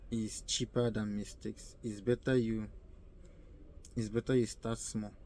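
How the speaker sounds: normal talking voice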